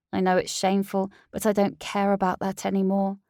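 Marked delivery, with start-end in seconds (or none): none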